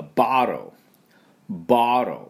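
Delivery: ボトル as "bah-ro", t barely sounded; American bottle. Shown as a demonstration. Teacher showing how Americans say it, ボトル as "bah-ro". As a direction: open "bah", flapped t in ボトル. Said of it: "アメリカ人の発音になりますと".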